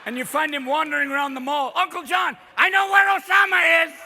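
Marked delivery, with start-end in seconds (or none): 1.8-3.9 high, loud, unhinged uncle rant; drugged uncle. Acted. Uncle rambling at nephew in mall, high loud and unhinged. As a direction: blurt it like an uncle on the wrong dose.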